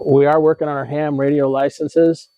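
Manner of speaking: ordinary delivery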